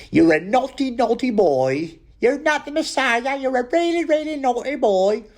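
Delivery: high mock-falsetto telling off a naughty boy; scolding old mother. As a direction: scold him like a shrieking Python mum. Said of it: "And she goes".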